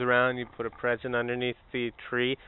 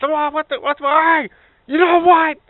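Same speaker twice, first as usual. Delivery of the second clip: much higher and louder jolted cry; startled shout; 14 dB louder than usual. Pretend. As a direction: woken with a fright. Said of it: "He gets".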